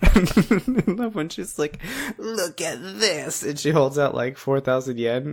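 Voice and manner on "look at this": high, slow, awed presentation; proud show-off. Retold or imitated. Girl proudly shows off her saved yen, high slow awed voice. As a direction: hold it up with slow wonder.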